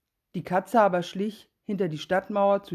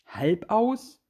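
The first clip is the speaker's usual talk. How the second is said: "Halb aus?" repeated with a rising question tune; incredulous echo; 3 semitones higher, 3 dB louder than usual. A narrator's reading. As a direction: echo the name, puzzled. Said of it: "Was du sagst". (verwundert nachgefragt)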